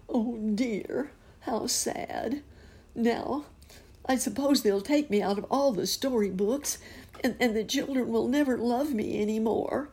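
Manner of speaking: high, mournful voice of a sad cat. Acted. Puss in Boots grieving behind a bush, high and sad.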